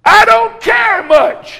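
high, loud, slow cry; Alice, careless. Acted. Alice answering the cat, loud and high. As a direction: fling it out like she's given up. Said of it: "said Alice".

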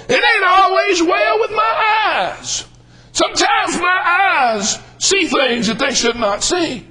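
high, slow shouted refrain; full-force preaching. Acted. Preacher warning the congregation, slow shouted refrain. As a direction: stretch every word like a sermon climax.

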